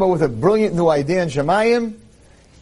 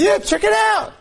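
eager show-off. Acted. Man shows off his passport.

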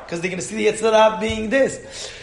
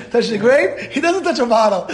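ordinary delivery

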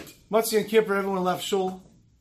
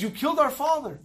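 higher, louder accusation; accusing outburst; 9 semitones higher, 4 dB louder than usual. Retold or imitated. Grieving son accusing the Dayan at the shiva, angry outburst. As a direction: hurl the blame at him. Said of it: "this one brother's outburst".

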